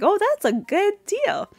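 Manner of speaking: lightly voiced Cliff, high and pleased. Cliff agreeing with his son, lightly voiced high and eager. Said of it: "Cliff is like".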